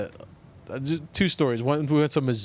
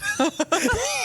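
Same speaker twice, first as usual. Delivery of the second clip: laugh. Real, not acted. Laughing at the phrase.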